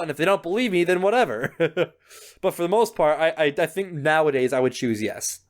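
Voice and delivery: ordinary talk, nothing marked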